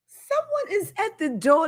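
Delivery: put-on high English-accented announcement; English lady announcing a visitor. Telling viewers someone has come, in a high English accent. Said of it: "get my English accent there".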